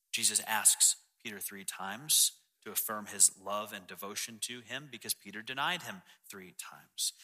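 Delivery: normal talking voice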